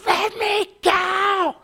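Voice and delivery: high, slow mock shriek; mock scream. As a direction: shriek it like a hysterical protester. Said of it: "screaming at the cop".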